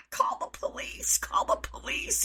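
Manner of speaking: unvoiced, disbelieving whisper; shocked whisper. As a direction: hiss it to the other trainers in alarm. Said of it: "the other trainers and I were like".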